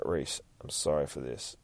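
low, hushed apology; quiet retold whisper, still voiced; confidential murmur. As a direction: lean in and speak softly, privately. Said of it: "Whispers into Reese's ear".

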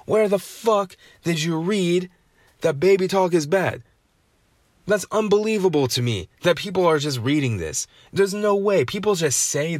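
high-pitched, exasperated disbelief; incredulous outrage. Real, not acted. Incredulous rant at myth believers.

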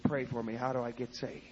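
low, soft broken-down plea; humbled by tears. Retold or imitated. Husband on his knees asking wife for prayer, low tearful-style plea. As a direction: ask it quietly, utterly humbled. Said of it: "tears started streaming down his face".

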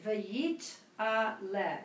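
slow, careful model reading. Shown as a demonstration. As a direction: read it slowly for a learner.

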